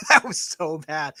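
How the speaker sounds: real laugh breaking into the words. Real, not acted. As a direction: say it while cracking up. Laughing at a terrible accent.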